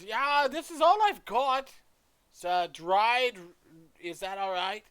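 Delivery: high, loud character voice; old-grandmother shopkeeper, chirpy. Acted. Shopkeeper showing a customer her goods, high and loud. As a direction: pipe up in a loud, high-pitched granny voice.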